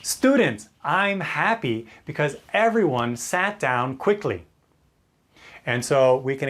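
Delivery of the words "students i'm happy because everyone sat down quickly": exaggerated happy. Shown as a demonstration. Teacher praising students for sitting down quickly, exaggerated happy. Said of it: "we could really exaggerate".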